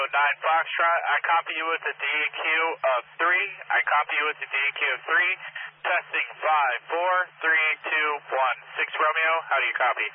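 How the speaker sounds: ordinary delivery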